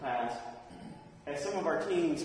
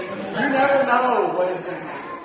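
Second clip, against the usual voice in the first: loud, higher, laughing through the words; 5 semitones higher, 11 dB louder than usual. Real, not acted. Preacher laughing after the naked-woman story. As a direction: laugh at the memory.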